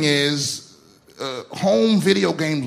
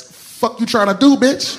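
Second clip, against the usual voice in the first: defiant challenge; 7 semitones higher, 5 dB louder than usual. Retold or imitated. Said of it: "is the same look as".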